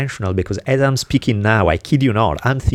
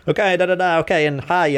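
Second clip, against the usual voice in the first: higher, breezy phone goodbye; retold phone sign-off; 9 semitones higher than usual. Speaker ending a phone call with a translated ciao, breezy. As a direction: wind up the call cheerfully, ending on hi. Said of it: "I'm like".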